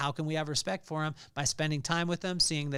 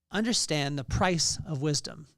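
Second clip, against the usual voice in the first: normal talking voice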